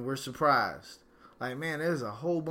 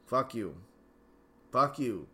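fed up; 4 semitones lower than usual. Real, not acted. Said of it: "I'm gonna say this multiple times".

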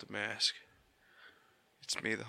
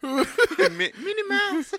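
Mickey Mouse voice; 12 dB louder, much slower than usual. Pretend. Mickey voice again.

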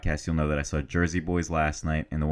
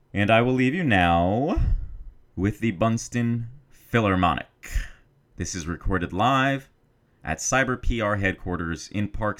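higher announcer intro voice; 3 semitones higher, 4 dB louder, slower than usual. Acted. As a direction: introduce the band like a radio host. Announcing the live recording.

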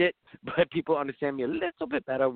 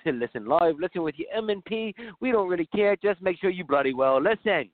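lightly put-on British accent, higher; hammy British radio voice; bad British accent; 4 semitones higher than usual. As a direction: drunk Brit host voice. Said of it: "let my really bad accent fly".

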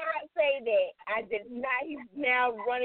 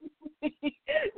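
laughing; 3 semitones higher than usual. Real, not acted. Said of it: "I'm gonna be making that face".

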